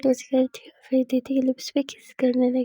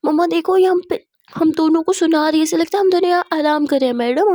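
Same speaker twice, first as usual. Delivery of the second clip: acted raised, louder tattling; indignant complaint; 4 semitones higher, 7 dB louder than usual. Daughter-in-law telling on her partner to mother, indignant whine. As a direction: complain to Mumma, voice pushed up.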